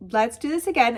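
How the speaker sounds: usual speaking voice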